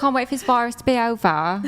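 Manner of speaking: laughing through a mock Essex accent; playful Essex accent imitation. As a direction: copy the Essex clip, giggling. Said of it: "that's like an Essex accent".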